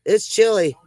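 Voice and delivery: normal talking voice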